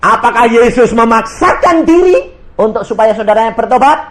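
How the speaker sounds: high, loud preaching over the congregation; full-force preaching. Acted. Preacher proclaiming to his congregation, loud and high. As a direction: proclaim it to the whole church.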